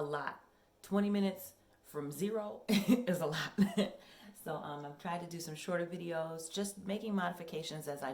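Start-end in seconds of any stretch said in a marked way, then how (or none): 0.8-3.9 laughing. Real, not acted. Laughs at own workout struggle.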